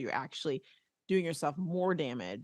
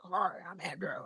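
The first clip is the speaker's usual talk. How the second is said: imitating trainer Andrew; 6 dB quieter than usual. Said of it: "and he talks like this".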